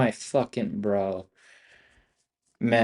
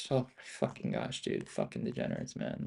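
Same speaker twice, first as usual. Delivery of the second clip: much lower and softer, sinking; low exhausted grumble; deflated groan; 13 semitones lower, 13 dB quieter than usual. Real, not acted. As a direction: let it sink in defeat.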